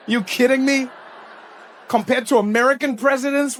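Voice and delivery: ordinary talk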